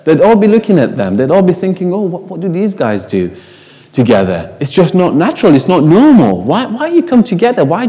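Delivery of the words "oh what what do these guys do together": ordinary delivery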